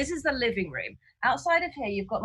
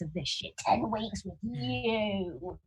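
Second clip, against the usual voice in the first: slow, soft grumble at the flatmate; hushed complaint to flatmate; quiet aside; 8 dB quieter, slower than usual. Acted. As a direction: mutter it so he won't hear. Muttering at flatmate, keeping voice down.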